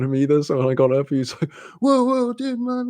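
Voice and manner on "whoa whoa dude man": high-pitched backing-off voice; imitated alarm. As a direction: imitate someone suddenly backing down, pitched well up. Startled man backs off, retold.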